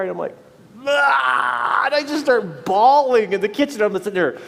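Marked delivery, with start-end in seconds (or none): 0.8-1.8 high loud anguished cry; loud retold wail. Crying in a stranger's kitchen, loud high wail. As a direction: let out a mock wail.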